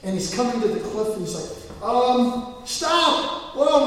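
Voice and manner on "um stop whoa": high, loud, desperate stop; panicked yell. Retold or imitated. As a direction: scream at a runaway horse. Rider heading toward the cliff.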